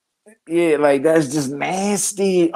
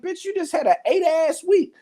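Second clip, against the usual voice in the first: incredulous; 11 semitones higher than usual. Real, not acted. Incredulous comeback.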